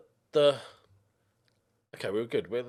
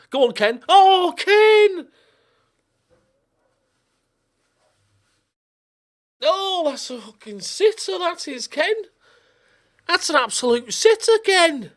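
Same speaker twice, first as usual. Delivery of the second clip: loud, high Northern English yelling at the striker; Northern accent; 15 dB louder than usual. Real, not acted. Manager yelling at his striker in Northern accent. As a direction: shout at Ken in a broad Northern accent. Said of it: "Don't know why I've gone Northern".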